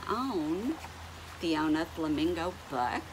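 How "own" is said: usual speaking voice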